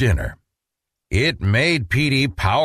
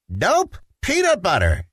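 put-on high, insistent little boy; stubborn child insisting; insistent Petey; 7 semitones higher than usual. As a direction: plant your feet and insist like a kid.

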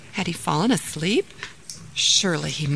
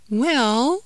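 put-on higher, drawn-out childlike pondering; thoughtful; 7 semitones higher, 4 dB louder than usual. Daughter considers mother's question, thoughtful. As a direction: stretch it out while thinking. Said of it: "said Jamila thoughtfully".

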